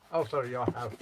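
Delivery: ordinary delivery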